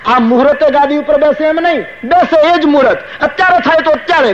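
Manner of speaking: high, eager plea to the sage; king urging his guru, high and eager. Acted. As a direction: press the point with high excitement. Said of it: "દશરથજી કહ્યું".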